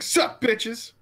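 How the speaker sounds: high, punchy greeting imitation; Kadant. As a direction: burst in with a high-pitched hello. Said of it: "she just jumps in saying".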